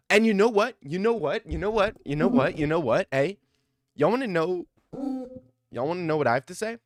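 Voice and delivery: rally the viewers like a showman; excited buildup; hyped up. Real, not acted. Hyping up his theory.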